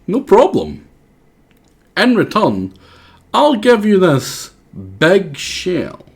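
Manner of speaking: a generous creature trading a gift; high character voice. Acted. Voicing a game character.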